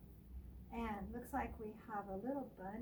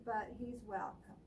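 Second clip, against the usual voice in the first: ordinary delivery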